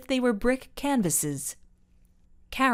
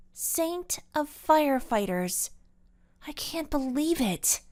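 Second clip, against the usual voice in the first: high-pitched, dismayed disbelief; let-down girl who can't believe it; disbelieving; 7 semitones higher than usual. A narrator's reading. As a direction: shake your head at it in a higher voice. Carol in disbelief at the news.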